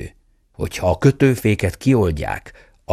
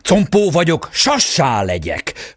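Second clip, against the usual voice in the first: high whoop, then slowing; whooping shout; 7 semitones higher, slower than usual. A narrator's reading. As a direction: whoop out the spell triumphantly. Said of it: "rikkantott a sárkány király". (magas rikkantás, majd lassulva)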